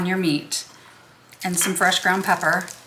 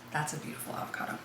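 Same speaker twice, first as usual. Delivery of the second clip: soft whisper to herself; whispered aside; 4 semitones lower, 14 dB quieter, faster than usual. Real, not acted. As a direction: breathe it out, almost unvoiced. Aside over avocado.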